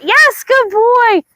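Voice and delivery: high, slow, excited dog praise. Real, not acted. Trainer rewards her dog for slack leash, high excited praise. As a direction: stretch out a bright yes for the dog.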